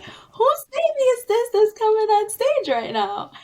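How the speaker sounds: loud, high, puzzled exclaim with a laugh; baffled exclaim. Retold or imitated. Dreamer at her sermon, loud bewildered exclaim. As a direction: blurt out who the child is. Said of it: "I'm thinking".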